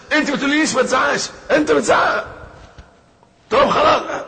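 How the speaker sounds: high, loud mock marital shouting; yelling husband. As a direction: yell back at her in an acted row. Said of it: "وتزعق أنت كمان".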